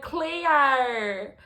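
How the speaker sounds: loud, drawn-out "Cleo!" in a mock Australian accent; hammed-up Australian call of "Cleo!". Actors calling out her original name, exaggerated Australian accent. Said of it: "in the Australian accent".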